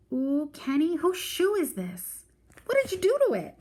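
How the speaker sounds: put-on a little girl turning suspicious; little sister holding up the ripped shoe; curious little sister. A narrator's reading. Joey finds the shoe.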